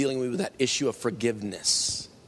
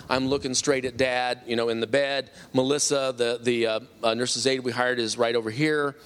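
usual speaking voice

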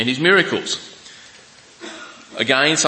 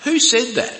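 sharply higher, doubting who said it; sceptical scoff; 12 semitones higher than usual. Retold or imitated. Preacher mimicking a listener doubting a claim, sceptical high scoff. Mock skeptic hearing a claim.

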